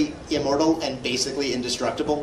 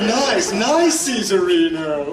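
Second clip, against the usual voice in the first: loud hammed-up catchphrase, starting high; theatrical cartoon-hero cheer; Joseph Joestar; 4 semitones higher, 5 dB louder, much slower than usual. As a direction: exclaim it high, then slide down slowly on the name. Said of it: "My favorite Joseph Joestar quote".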